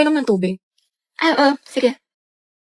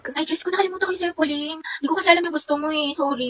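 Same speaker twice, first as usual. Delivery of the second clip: awkward apology; 10 dB quieter than usual. Acted. Forgot friend's gift, awkward apology.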